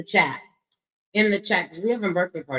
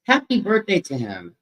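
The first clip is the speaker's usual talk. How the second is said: ordinary talk, nothing marked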